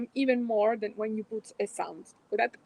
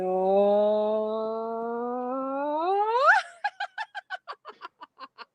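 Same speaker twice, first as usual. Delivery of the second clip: rising laugh; 4 dB louder than usual. Shown as a demonstration. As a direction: let the laugh soar upward. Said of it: "we go up and we laugh".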